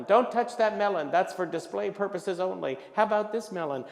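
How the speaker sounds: loud, high reprimand; scolding clerk. Acted. Clerk guarding the display melons, scolding. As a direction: scold the customer, then push an alternative.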